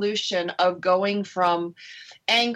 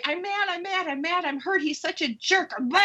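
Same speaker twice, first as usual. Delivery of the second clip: higher, hammed-up angry journal rant; mock angry venting; 9 semitones higher than usual. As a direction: play-act your old furious scribbles, higher. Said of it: "sometimes I'd write".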